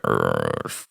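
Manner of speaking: a deep, exasperated urgh; annoyed low groan. Retold or imitated. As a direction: groan low like someone fed up. Ruth annoyed, voiced low.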